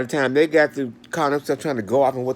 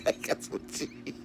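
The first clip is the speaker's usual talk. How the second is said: breathy whisper, slow; 12 dB quieter, slower than usual. Retold or imitated. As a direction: drop to a whisper for the punchline. Retold rant trailing off.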